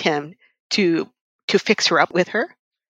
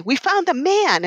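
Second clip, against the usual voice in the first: quoting the friends; 8 semitones higher, slower than usual. Retold or imitated. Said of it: "her friends say".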